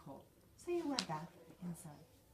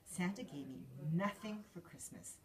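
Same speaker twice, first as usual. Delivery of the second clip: ordinary delivery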